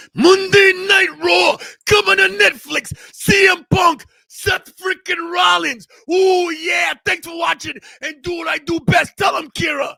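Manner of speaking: high, shouted ring hype; wrestler promo voice. Pretend. Host plugging Monday Night Raw, shouted wrestler promo. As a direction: belt out each slogan like a wrestler.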